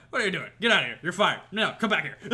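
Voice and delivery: loud, high, rapid-fire boss orders; Jonah. Acted. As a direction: fire and recall someone in one breathless rant. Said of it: "immediately it's just like".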